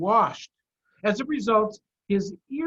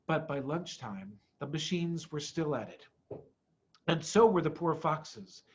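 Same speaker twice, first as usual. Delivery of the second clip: ordinary delivery, nothing marked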